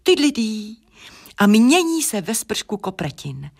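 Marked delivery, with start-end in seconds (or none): 0.0-0.8 high sung trumpet fanfare; trumpet call. A narrator's reading. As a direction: toot it like a merry trumpet. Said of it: "Hlas trubky zní".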